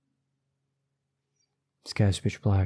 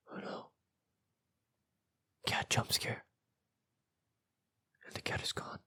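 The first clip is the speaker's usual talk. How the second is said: barely audible, tense whisper; hushed whisper; 13 dB quieter than usual. A narrator's reading. Reader after the cat vanishes in the story, hushed whisper. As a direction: whisper the scare very softly. Jump scare in scary story.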